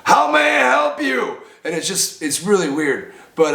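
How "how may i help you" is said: imitated high, drawn-out yell; high, slow shouted greeting; counter staff shouting. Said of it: "they start off by, like".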